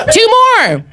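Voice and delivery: high, punchy call to the crowd. Real, not acted. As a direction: shout the count out high. Host calls out to audience.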